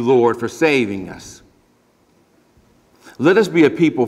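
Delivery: earnest pastoral prayer, unhurried. Acted. Pastor leading the congregation in closing prayer, earnest and unhurried. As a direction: pray it sincerely for the room.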